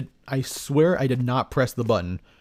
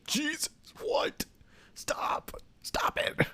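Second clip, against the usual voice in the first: whisper; 9 dB quieter, much slower than usual. Real, not acted. Reacts to whispering voice.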